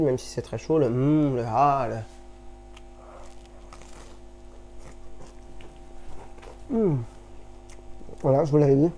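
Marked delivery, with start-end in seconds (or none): 0.8-7.0 hammed-up mm, ah and hmm of delight; exaggerated tasting noises. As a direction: ham up the tasting sounds. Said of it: "je vais refaire les mêmes simagrées".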